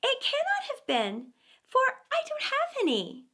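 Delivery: high, pleading lamb voice. A narrator's reading. Lamb protesting to the wolf, high and pleading. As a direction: insist innocently, small and high.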